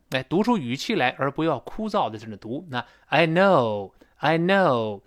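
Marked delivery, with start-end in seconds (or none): 3.1-5.0 "know" drawn out, sliding down at the end; apologetic, lengthened "know"; long falling "know". Shown as a demonstration. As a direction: stretch "know", then let the pitch glide down. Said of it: "特别这个know稍微拖长".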